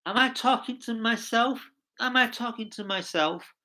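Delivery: high, puzzled self-questioning voice; a creator wondering if anyone listens; frustrated creator. Retold or imitated. Host voices a lonely creator, high and puzzled. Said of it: "who's actually thinking".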